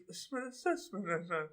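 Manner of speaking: soft, slow babble in a trance; possessed sleep-talker. Retold or imitated. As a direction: murmur gibberish like a sleepwalker. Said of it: "now she's like this".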